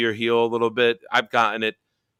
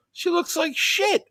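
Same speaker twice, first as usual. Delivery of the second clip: high, slow mock disgust; slower than usual. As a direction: sneer it in a squeaky voice. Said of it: "I go".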